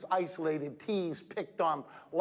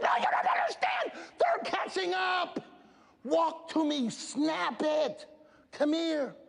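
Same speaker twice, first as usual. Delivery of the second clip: much higher, shouted hurry-up; a coach shouting from the sideline; urgent coach yell; 11 semitones higher, slower than usual. Retold or imitated. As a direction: yell at her to get moving. Coach yelling at stopped runner.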